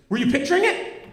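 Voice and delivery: imitating a fretful mother, pitched high; high accusing question. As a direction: squeak out the question in disbelief. Mother demands at missed swing.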